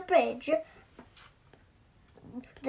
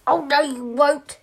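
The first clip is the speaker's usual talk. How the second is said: mild defiant shout; 7 dB louder than usual. Acted. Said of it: "shouted the biggest goat".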